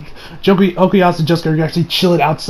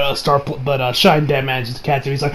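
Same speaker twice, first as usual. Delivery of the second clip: ordinary delivery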